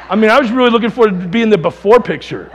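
real laughter at his own joke; laughing joke. Real, not acted. Joking, laughing.

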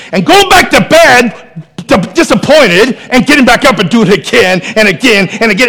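fervent pulpit delivery; preaching at full force. Acted. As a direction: preach it hard and build.

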